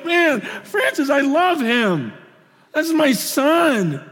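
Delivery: slow, tender voice of God, lightly played; voicing God fondly. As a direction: say it slowly like a proud father. God voiced lovingly about Francis.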